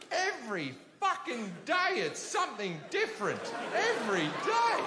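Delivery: imitated mother at her wits' end; high-pitched, mock exasperated outburst. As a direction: act out an exasperated parent.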